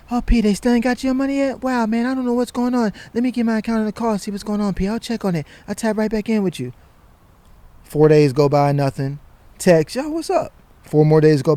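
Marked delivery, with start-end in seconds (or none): 0.1-6.7 high, breezy excuse-making voice; smooth, high fake-apologetic friend; mock friendly stalling. 10.0-10.5 casual text. Retold or imitated. Texting the friend.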